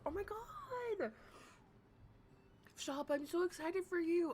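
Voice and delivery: high, squealing delight; thrilled. Real, not acted. Cheering her friend's first video plan, squealing excitedly. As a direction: gush over the good news.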